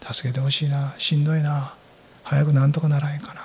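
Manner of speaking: soft, tired plea under the breath; soft weary murmur, barely voiced at the end; quiet murmur. Shown as a demonstration. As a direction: murmur it like a small private request for help. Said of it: "小さな声でも言うことができるでしょ".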